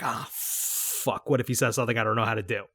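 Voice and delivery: low, fast panicked whisper; worried whisper. Retold or imitated. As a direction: mutter it under your breath in a panic.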